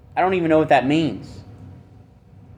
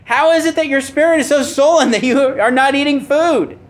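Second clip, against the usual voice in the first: higher, faster voice of a pushy wife; Jezebel voiced; 9 semitones higher, 3 dB louder than usual. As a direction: play the wife, quick and high, fussing at her husband. Preacher voices Jezebel to Ahab.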